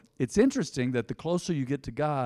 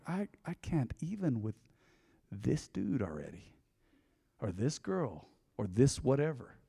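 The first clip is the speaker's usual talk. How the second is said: exasperated, trailing off; 3 semitones lower, 9 dB quieter than usual. Retold or imitated. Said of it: "you're like".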